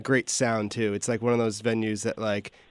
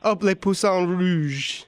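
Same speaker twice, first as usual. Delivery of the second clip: put-on hammy French accent, slow and higher; fake French accent; 7 semitones higher, much slower than usual. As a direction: overdo a French accent on the name. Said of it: "I said it with a terrible French, fake French accent".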